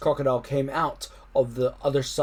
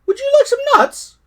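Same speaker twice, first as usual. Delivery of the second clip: squeaky monkey; 9 dB louder than usual. Acted. Monkey voice in story.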